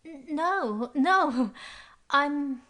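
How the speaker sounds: stammering, high trembling voice, slow. A narrator's reading. Mary Louise answering Fraser, trembling with fear. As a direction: try to sound calm while scared. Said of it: "Her voice was trembling".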